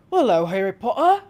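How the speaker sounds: badly faked Hagrid accent greeting; Hagrid impression. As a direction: greet him like Hagrid in a half-baked Hagrid accent. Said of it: "My accents are fantastic".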